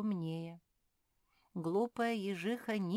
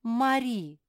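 high, loud cry; sharp exclamation of reproach; exclaimed name; 7 semitones higher, 12 dB louder than usual. A narrator's reading. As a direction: call her back in protest. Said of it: "воскликнула Софи".

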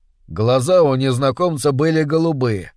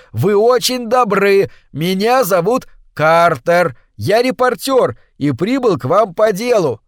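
shouting; 6 semitones higher, 4 dB louder than usual. A narrator's reading. Shouting over waterfall noise.

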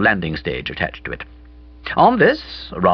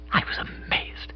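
hushed narration; 10 dB quieter than usual. A narrator's reading.

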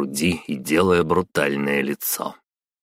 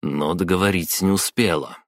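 ordinary delivery

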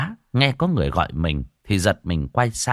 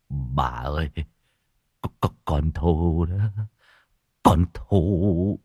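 giọng nghẹn, lắp bắp; cố nói trong nước mắt; nghẹn ngào; 5 semitones lower, slower than usual. Acted. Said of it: "nước mắt lưng tròng". (giọng nghẹn ngào khóc)

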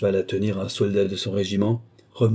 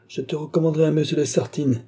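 heated; 7 semitones higher than usual. A narrator's reading. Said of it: "s'échauffant à mesure que sa fille s'adoucissait".

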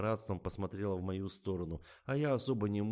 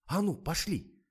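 eager exclamation; 11 semitones higher, 8 dB louder, slower than usual. A narrator's reading. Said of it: "азартно воскликнула Лиза".

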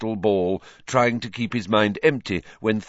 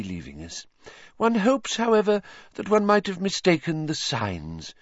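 dewy seer; 8 semitones higher than usual. A narrator's reading. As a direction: sigh it with dewy modesty. Trelawney dewy smile reply.

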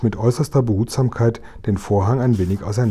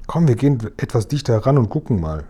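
ordinary delivery, nothing marked

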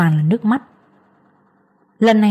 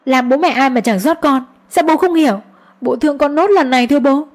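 put-on raised, pleading voice begging for her child; desperate pleading; 5 semitones higher than usual. Daughter-in-law begs her father-in-law to see her sick son, pleading.

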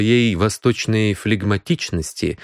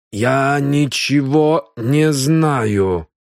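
very slow, higher, stubborn denial; 4 semitones higher, much slower than usual. Acted. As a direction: drag the words out in fear. Frightened Indian repeats denial.

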